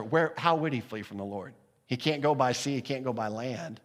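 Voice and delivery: normal talking voice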